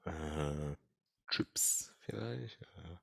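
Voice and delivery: slow, soft, spaced-out mumbling; stoned. Acted. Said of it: "Sag mal, bist du bekifft?".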